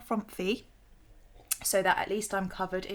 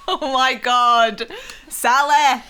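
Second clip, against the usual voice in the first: laughing out loud; 7 semitones higher, 10 dB louder, slower than usual. Real, not acted. Laughing at name mix-up.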